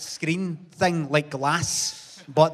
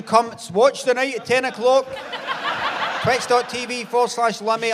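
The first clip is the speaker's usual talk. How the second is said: stream ad plug; 7 semitones higher, 3 dB louder than usual. Pretend. Mock stream advert.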